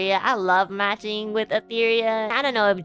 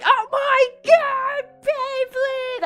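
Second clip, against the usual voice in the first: much higher, slow, gushing squeal; hammed-up childish excitement; mock excited boys; 14 semitones higher, much slower than usual. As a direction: squeal like kids spotting a toy. Guys crowding the Beyblade.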